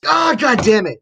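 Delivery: loud, high-pitched cursing; exasperated outburst. Acted. Lab assistant as the robot knocks over papers, exasperated outburst. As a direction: cry out in sudden exasperation.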